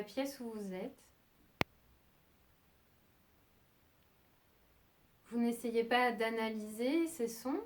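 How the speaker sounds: soft, unhurried meditation voice with long pauses; calm yoga nidra guidance, leaving long silences; guided meditation. Acted. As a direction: guide them gently towards sleep, phrase by phrase.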